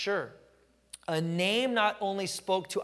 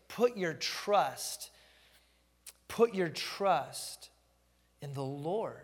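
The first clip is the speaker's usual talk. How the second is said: "Put your trust" drawn out very slowly, then "in the Lord" settled down; preacher driving home the point, slowly and deliberately; slow emphatic stress; much slower than usual. Shown as a demonstration. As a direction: press every word of "Put your trust", repeat it, and land on "Lord". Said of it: "the operative word in that whole thing is trust the Lord".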